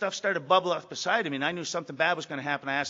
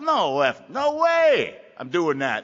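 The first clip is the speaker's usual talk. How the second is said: defiant newcomer; 8 dB louder, much slower than usual. Retold or imitated. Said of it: "That's the default".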